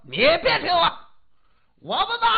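put-on high, loud drunken refusal; drunken yell. Drunk father refusing to be pushed out, loud yell. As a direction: bellow it, stubborn and drunk. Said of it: "喝多了".

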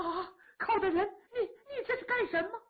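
high, tearful, startled alarm; frightened cry. Acted. The princess cries out in fright at Kou Zhun opening the coffin, high and tearful. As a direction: gasp out in shock. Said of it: "可把郡主吓坏了".

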